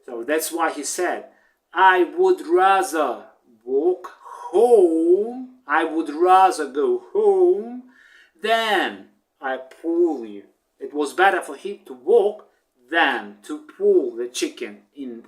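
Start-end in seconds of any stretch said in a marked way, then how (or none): 1.7-10.4 louder defiant refusal, rising on home; rooster refusing. Acted. Rooster telling chicken no, louder and defiant. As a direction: throw the refusal back, emphatic.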